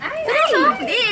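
high, loud shocked "seryoso"; surprised. Real, not acted. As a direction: caught off guard, voice shoots up. Reacting to torn gift wrap.

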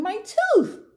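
loud, very high wail; hammed-up high toothache cry; screamed in pain. A narrator's reading. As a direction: shriek it like a hurt little dinosaur. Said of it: "Tiny screamed".

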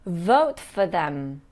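Vote for them slowly, for with a short weak vowel; patient teacher model; slow demo. Shown as a demonstration. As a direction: draw out vote, keep for light. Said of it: "That's right".

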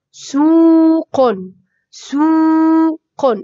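سوق very slow, oo held on one note; elongated oo. Shown as a demonstration. Teacher models madd with waw, vowel stretched. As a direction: hold the oo of سوق long before the q. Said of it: "مد بالواو".

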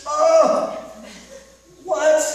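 high, loud incredulous "Oh, what?"; mock disbelief as Jesus; Jesus exasperated. Jesus baffled by disciples, loud incredulous cry. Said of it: "I just imagine that Jesus went".

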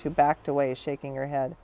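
ordinary delivery, nothing marked

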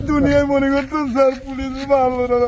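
tearful, heartfelt confession; weeping with gratitude; crying. Real, not acted. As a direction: praise them through sobs. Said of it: "তুই কাঁদিস না ভাই".

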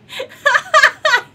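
high burst of laughter; real laugh; loud laugh. Real, not acted. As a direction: let the laugh break out. Laughing at game chaos.

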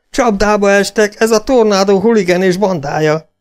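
loud, high cry of alarm; yelling in alarm. A narrator's reading. Robber warning of an ambush ahead, yelled. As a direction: shout the warning, pitched high throughout. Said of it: "ordította Gunner".

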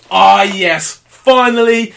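loud gleeful cheer; thrilled. Real, not acted. Vlogger cheering to viewers over FIFA, loud and gleeful. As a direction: celebrate it out loud.

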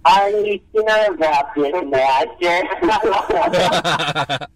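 high, laughing imitation of Ubu; mock Ubu voice. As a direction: parody the henchman, half laughing. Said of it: "He's really gruff".

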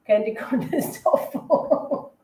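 real giggles breaking up the speech; laughing. Real, not acted. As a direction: laugh through the words. Laughs while knocking candy corn.

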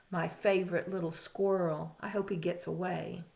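usual speaking voice

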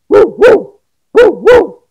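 playful pretend barking; loud mock dog barks. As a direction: bark it out like a happy dog.

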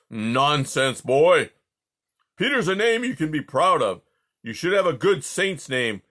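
loud, scornful royal bluster; dismissive booming Tsar. Acted. Tsar dismissing the boy's name, loud scorn. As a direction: brush the boy off scornfully.

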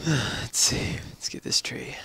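sigh, then whisper it to yourself; hushed and unvoiced, thinking aloud. Real, not acted. Whispering to himself while playing.

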